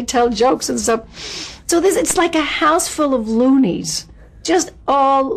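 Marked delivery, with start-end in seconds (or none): none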